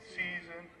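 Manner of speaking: loud, fast announcer opening to the crowd; announcer welcome. Acted. League president greeting the crowd at opening day, loud announcer voice. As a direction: boom it out over the field like a PA announcer. Opens the league season ceremony.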